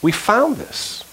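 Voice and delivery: high, very slow, sly and innocent; feigned innocence. Retold or imitated. Brothers handing their father the coat, sly and slow. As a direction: play the brothers faking surprise. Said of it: "Watch what they say".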